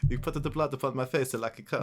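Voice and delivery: put-on hammy broken-English Italian accent; mock Italian accent. Host plays along with the co-host's bit, in a mock Italian accent. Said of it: "that accent you're doing".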